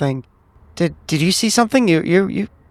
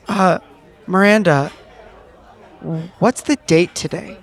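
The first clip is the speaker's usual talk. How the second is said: character asking. Acted. Merchant character asks for the date.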